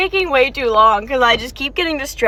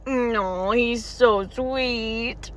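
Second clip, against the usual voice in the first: very slow, soft, fond murmur; soft fan gushing to viewers; tender; 2 semitones lower, 6 dB quieter, much slower than usual. Real, not acted. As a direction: melt over how sweet he is. Gushing over a character.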